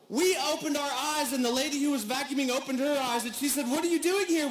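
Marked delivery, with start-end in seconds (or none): 3.7-4.5 high, louder startled "What are you doing here?"; a cleaner jumps at stowaways; startled question. Retold or imitated. Cleaning lady finding passengers on empty plane, startled.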